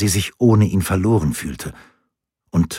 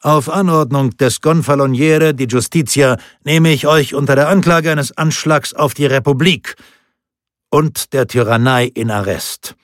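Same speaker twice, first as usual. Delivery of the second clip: loud, high formal arrest; booming; 6 semitones higher, 5 dB louder than usual. A narrator's reading. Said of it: "dröhnte der Hauptmann".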